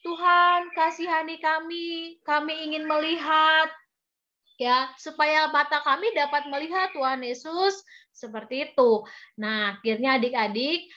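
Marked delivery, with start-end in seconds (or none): 0.1-7.8 high, sustained plea to be healed; acted storybook begging; blind men pleading. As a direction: call out high, then hold the note pleadingly. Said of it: "kedua orang buta ini bilang".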